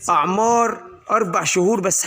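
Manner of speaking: usual speaking voice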